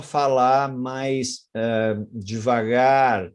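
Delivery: drawn-out, halting slow speech; slowing right down. Shown as a demonstration. Speaker showing a liar's speech slowing, very slow and halting. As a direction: drag the words out with a hesitant pause. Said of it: "nessa velocidade".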